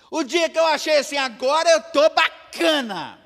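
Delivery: high, loud fervent preaching; preaching at full force. Acted. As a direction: drive it home to the church. (pregação inflamada e alta)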